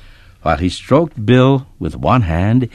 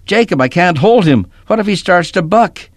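panicked; 11 semitones higher, 4 dB louder than usual. A narrator's reading. Boy scared, horse tangled.

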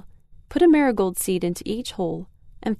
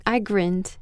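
ordinary delivery, nothing marked

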